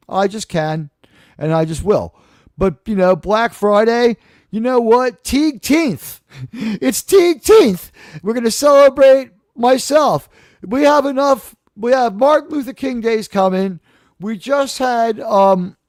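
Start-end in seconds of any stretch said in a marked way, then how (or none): 4.5-7.9 high, laughing proclamation of a made-up holiday; mock holiday cheer. Streamer hyping his own joke holiday to chat, laughing. 8.2-11.6 high, loud, fake-indignant grumble; mock ignorant rant. Streamer feigning ignorance about holidays to chat, mock indignant. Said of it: "purposefully and ironically ignorant".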